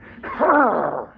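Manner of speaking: a loud snorting noise like a frustrated horse; imitated horse snort. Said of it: "if I may do that".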